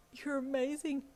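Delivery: high, awestruck timid voice; awed co-worker. A narrator's reading. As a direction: breathe out high-pitched admiration.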